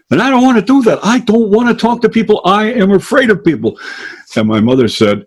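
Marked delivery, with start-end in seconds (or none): 0.1-3.8 put-on high, whiny child voice pleading with mom; a small boy begging not to perform; scared little boy.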